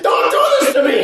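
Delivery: very high, comic wail; mock pleading shout. Begging the drunk friend in the story, mock pleading shout. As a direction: shriek it as a put-on plea. Acted plea amid laughter.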